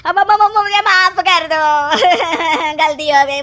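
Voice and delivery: half-laughing, half-crying plea; hammed-up blubbering apology; tearful plea. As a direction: beg forgiveness through mock sobs. Fake baba begs forgiveness.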